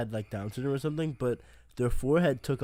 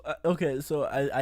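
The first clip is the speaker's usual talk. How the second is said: ordinary talk, nothing marked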